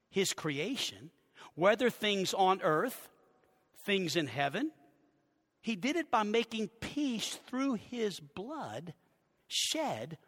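measured, phrase by phrase, rising to the last words; slow scripture reading. Real, not acted. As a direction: read deliberately, pausing before the close. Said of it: "I'll read it slowly".

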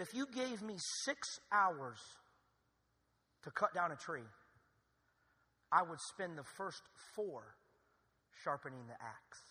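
slow, low, weighty quote; slow quoted saying. Retold or imitated. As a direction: let each phrase sink in. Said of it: "This is what Abraham Lincoln said".

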